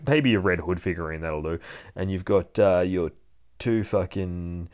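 normal talking voice

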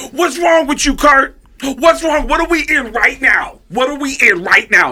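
high, loud scolding; loud comic tirade; Tracy Morgan impression. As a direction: berate him in a high shouted mimicry. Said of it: "and he said".

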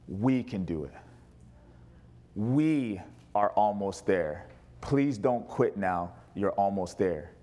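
slow and soft, almost pleading; tender, slow, soft reassurance. Retold or imitated. Jesus softly urging a struggling believer, tender and slow. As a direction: coax him gently not to give up. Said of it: "He says, Listen".

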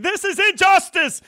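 high, loud mock outrage, slowed down; mock protest cry. Vegas fan chanting at the Pac-twelve, mock protest shout. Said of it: "I'll start saying".

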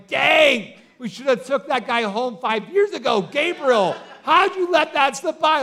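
loud, high, hammed-up divine outrage; playful shouted frustration, playing an exasperated God; mock exasperation. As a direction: scold an angel in comic disbelief. Acts out exasperated God scolding Gabriel.

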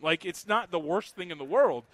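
ordinary talk, nothing marked

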